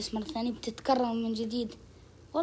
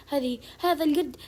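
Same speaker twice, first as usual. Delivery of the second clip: ordinary talk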